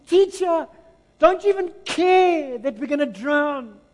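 high, loud, slowed panicked plea; frantic cry. Acted. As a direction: cry out to the teacher as if about to drown. Disciples' frantic cry voiced.

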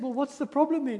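a parent baffled by their crying son; puzzled parents. Retold or imitated. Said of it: "And the parents said".

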